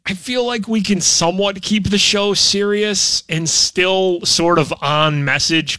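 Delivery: usual speaking voice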